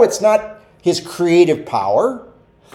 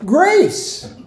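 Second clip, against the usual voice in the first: high, bright call of the answer; eager answer; 13 semitones higher than usual. Shown as a demonstration. Pastor calling out the answer to the congregation, high and eager. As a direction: sing out the answer like a quiz winner.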